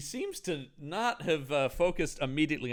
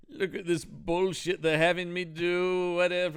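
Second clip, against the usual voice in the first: James Mason; 4 semitones higher than usual. Acted. The host griping about the job, mock James Mason. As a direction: grumble grandly in a put-on actor's voice. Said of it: "Mason impressions".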